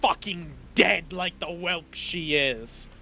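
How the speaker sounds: father shouting scorn at his son; furious shout. Acted. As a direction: bellow his contempt down at the son. Villain father rages at son.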